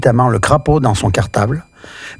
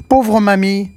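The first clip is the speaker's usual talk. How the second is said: put-on high, childlike cry of pity; a boy exclaiming in sympathy, pitched up; little boy crying out; 7 semitones higher, slower than usual. A narrator's reading. Petit Pierre to the fallen witch, high childlike cry. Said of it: "Petit Pierre s'écrie".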